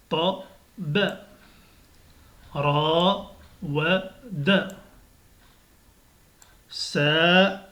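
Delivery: syllabes lues très lentement; slow reading. Shown as a demonstration. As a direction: lis chaque syllabe doucement. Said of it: "il faut vraiment lire doucement". (lecture lente et détachée)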